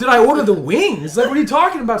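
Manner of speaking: high, incredulous self-quote; hammed-up disbelief about the wings; mock confusion. Confused dreamer quoting himself.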